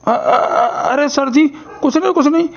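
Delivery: usual speaking voice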